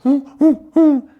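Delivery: high, loud hooting coo; cooing hoots. Acted. Old Calculus hooting happily at the dog, high and loud.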